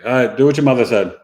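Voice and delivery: loud, quick dad brush-off; a touch of a weary father; tired dad. Retold or imitated. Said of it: "the dad's like".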